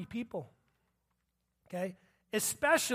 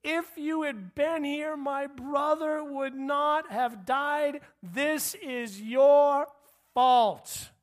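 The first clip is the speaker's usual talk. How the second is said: higher, slow and loud, blaming; accusing Martha; 5 semitones higher, 6 dB louder, much slower than usual. Retold or imitated. Martha confronting Jesus over her brother's death, slow loud blame. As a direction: lean hard into fault. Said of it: "Martha said to Jesus".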